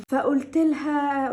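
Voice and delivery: ordinary talk, nothing marked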